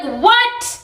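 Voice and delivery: high rising shocked question; stunned disbelief; shocked what. Retold or imitated. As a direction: react in disbelief. Shocked reaction to news.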